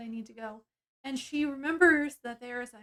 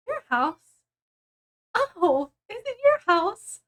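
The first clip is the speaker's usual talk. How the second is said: louder, pitch climbing high on the challenge; indignant comeback; 8 semitones higher, 7 dB louder, slower than usual. Retold or imitated. Woman arguing with him over whose house, indignant. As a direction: throw it back in mock outrage, rising higher. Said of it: "'cause they start arguing".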